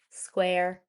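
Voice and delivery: ordinary delivery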